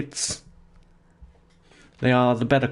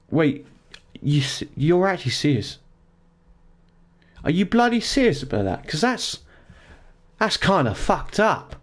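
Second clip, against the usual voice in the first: incredulous; 4 semitones higher than usual. Real, not acted. Disbelief at kid's name.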